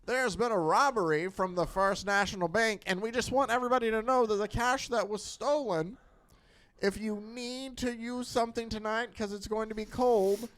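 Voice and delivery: put-on light old-time radio announcer; lightly voiced newsreel announcer; 1940s announcer voice. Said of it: "nineteen forties voice you're using".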